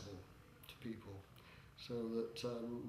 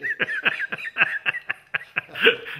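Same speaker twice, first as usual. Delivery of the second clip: big high burst of laughter; loud laugh; 18 dB louder than usual. Real, not acted. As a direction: laugh out loud at your own joke. Laughs at own joke.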